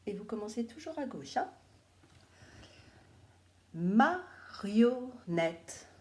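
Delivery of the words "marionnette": Marionnette said very slowly, syllable by syllable; slow, clear dictation to children. Shown as a demonstration. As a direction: spell out each syllable of Marionnette for the pupils. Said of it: "il faudra bien les écouter".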